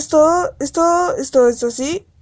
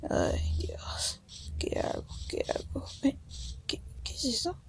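susurro sin voz, temeroso; susurro asustado; 2 semitones higher, 16 dB quieter than usual. Pretend. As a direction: cuchichea como si algo acechara. (susurro asustado al verse perdido)

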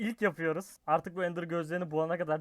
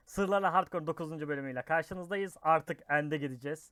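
ordinary talk, nothing marked

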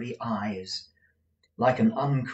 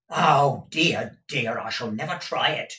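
alarmed, loud opening that subsides; appalled; 4 semitones higher, 7 dB louder than usual. A narrator's reading. Gride recoiling from Peg's threat, appalled. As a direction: back down in dismay. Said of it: "appalled by the mention of the word".

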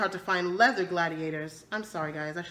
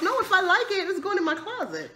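laughing; 11 semitones higher than usual. Real, not acted. Laughs about keeping clothes.

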